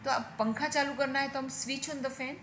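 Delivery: ordinary talk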